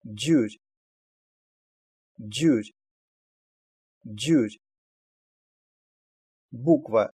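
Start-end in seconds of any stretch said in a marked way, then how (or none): 0.0-4.6 "джурь" opening on a voiced "дж"; voiced "дж" onset. Shown as a demonstration. Teacher giving an example for learners, voiced "дж" starting "джурь". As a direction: say "джурь" distinctly, repeated with pauses. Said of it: "всегда произносится «дж»".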